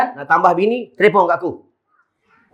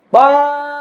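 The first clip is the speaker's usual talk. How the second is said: calling out; 9 semitones higher than usual. Retold or imitated. Dead wife calling husband from grave.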